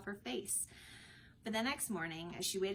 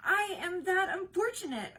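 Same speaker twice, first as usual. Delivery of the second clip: passionate; 11 semitones higher, 8 dB louder than usual. A narrator's reading. Orlando insists passionately.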